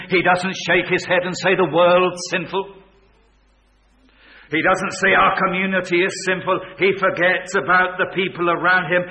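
loud, high, driving pulpit voice; thundering sermon delivery; full-force preaching. Acted. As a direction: preach it out to the back row.

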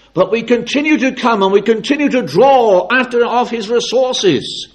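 high, loud, impassioned pulpit cry; ringing proclamation, slowing at the end; full-force preaching. Acted. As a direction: drive each phrase home at full voice.

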